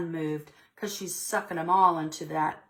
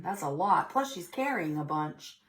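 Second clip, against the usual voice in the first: usual speaking voice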